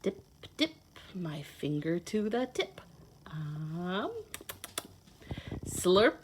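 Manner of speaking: lively storybook read-aloud; reading to children. Acted. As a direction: read it like a picture book to little kids. Reads picture book to children.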